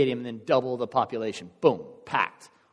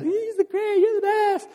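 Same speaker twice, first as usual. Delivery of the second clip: high, loud squeal of fans cheering; adoring crowd; 5 dB louder than usual. Acted. Crowd cheering on their hero, high-pitched adulation. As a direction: voice a gushing crowd in falsetto.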